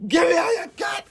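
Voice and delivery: high-pitched, hammed-up shout; Al Pacino. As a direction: yell it like a bad actor impression. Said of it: "I can't do it".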